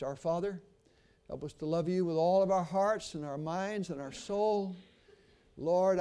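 earnest pastoral prayer with swells on key pleas. Acted. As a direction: lead the church in prayer. Preacher leads closing prayer.

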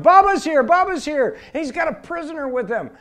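high, loud cries spreading the news; excited shouting. Retold or imitated. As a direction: call it out to the whole camp. Camp runs out shouting the news.

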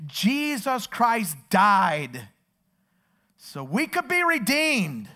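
high, loud, driving sermon delivery; full-force preaching. Real, not acted. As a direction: proclaim it to the whole congregation, hammering each phrase.

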